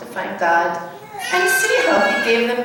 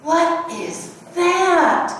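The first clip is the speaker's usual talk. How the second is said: slow, awed question; in wonder; 6 semitones higher, much slower than usual. A narrator's reading. Little cub staring at the sky lights, in wonder. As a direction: ask it wide-eyed and slow. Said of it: "asked in wonder".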